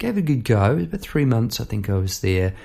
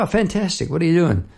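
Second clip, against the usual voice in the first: mum's bright reply; 7 semitones higher than usual. Retold or imitated. Mum reacting to son's news.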